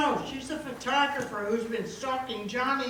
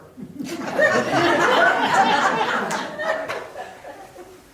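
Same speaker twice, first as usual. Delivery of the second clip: loud high nervous giggle; nervous high laugh; 9 semitones higher, 10 dB louder than usual. Acted. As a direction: laugh off the suspicion. Nervous laugh deflecting recognition.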